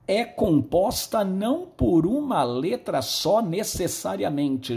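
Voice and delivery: ordinary talk, nothing marked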